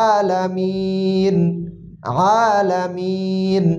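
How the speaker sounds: slow, stretched আলামিন with a throat-squeezed first vowel; pressed throat sound. Shown as a demonstration. Teacher giving an example word, slow throat-pressed আলামিন. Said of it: "গলার ভিতর থেকে চাপ দিয়ে".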